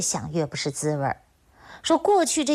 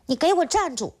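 father barks at his son walking out; shout; 8 semitones higher than usual. Acted. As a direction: yell at the son to stop him leaving. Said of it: "就对他儿子喊".

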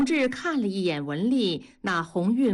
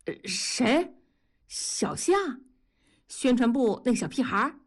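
startled and higher, disbelieving; 4 semitones higher than usual. A narrator's reading. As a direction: jumps in surprise, voice shooting up. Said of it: "大庄吓了一跳说".

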